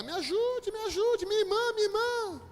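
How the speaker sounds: very high, wailing imitation; mock screaming for help. Woman begs help for fallen sister, mock screaming. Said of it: "começou a gritar".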